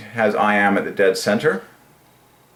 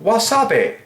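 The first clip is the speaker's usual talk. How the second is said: high sing-song; 12 semitones higher than usual. Real, not acted. Singing the word.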